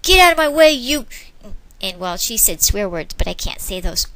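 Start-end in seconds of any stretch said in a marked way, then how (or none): 0.0-1.1 loud, higher road-rage yell; angry yell. Acted. Driver cut off, yelling angrily at him. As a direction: snap at the other driver. Driver swearing at other car.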